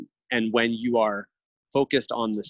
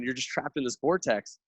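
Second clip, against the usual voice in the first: usual speaking voice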